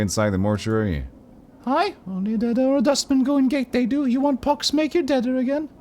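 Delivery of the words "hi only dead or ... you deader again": put-on very high, small girlish voice; girl of ten voice. Player voicing hooded Pox in a high girlish voice. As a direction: squeak it out like a ten-year-old girl. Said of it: "I'm doing a silly voice".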